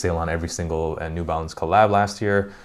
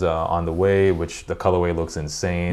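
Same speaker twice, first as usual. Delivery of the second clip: normal talking voice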